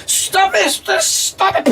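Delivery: very high squealing plea; squealing devil. Acted. Devil beaten by his hammer, squealing high. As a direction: squeal for it to stop.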